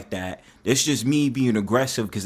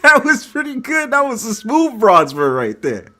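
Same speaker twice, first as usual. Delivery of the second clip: mock high praise; 10 semitones higher, 6 dB louder than usual. High-pitched mocking praise.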